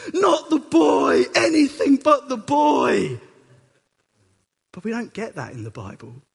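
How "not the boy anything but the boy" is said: slow, loud, higher pleading wail; Hollywood anguish. Retold or imitated. Abraham in a Hollywood version begging God, loud hammed-up plea. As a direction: overplay the despair like a movie. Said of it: "a Hollywood moment".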